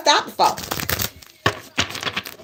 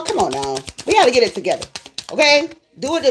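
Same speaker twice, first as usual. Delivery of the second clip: ordinary talk, nothing marked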